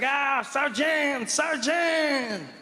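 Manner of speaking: high, drawn-out mock French cry; alarmed French soldier. Soldier running into barracks to warn sergeant, mock alarm. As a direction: call out in hammed-up panic, stretching the words. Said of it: "the French running into the barracks like".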